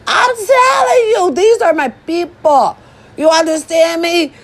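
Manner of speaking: high, fired-up near-shout; riled-up declaration. Acted. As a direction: shout it at the doubters.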